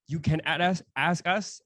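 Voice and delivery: normal talking voice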